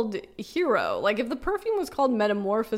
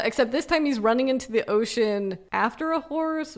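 usual speaking voice

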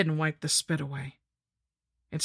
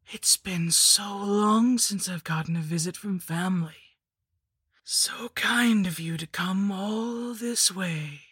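slow, higher whispered crone voice; wheezing hag whisper; 3 semitones higher than usual. Acted. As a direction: breathe it out like a dying witch. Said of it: "it wheezed in a voice like sand falling".

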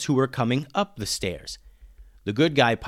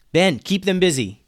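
raised, louder narrated yell; 6 semitones higher, 6 dB louder than usual. A narrator's reading. Alex orders Ben during the stairwell fight, yelling. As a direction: call out over the noise to a friend. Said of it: "yelled Alex".

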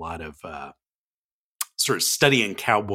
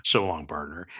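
cowboy dialect; 7 semitones lower, 6 dB quieter than usual. Shown as a demonstration. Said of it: "I will do this in dialect".

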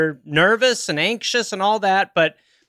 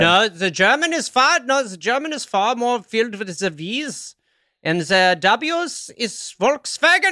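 higher, comic German accent with hard V's; 5 semitones higher than usual. Shown as a demonstration. Host demonstrating his German accent, high and hammed-up. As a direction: exaggerate the V's like a cartoon German. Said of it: "Do your German".

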